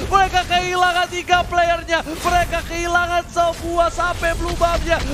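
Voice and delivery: excited shouting. Real, not acted. Teamfight climax, caster screaming.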